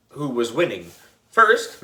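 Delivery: ordinary delivery, nothing marked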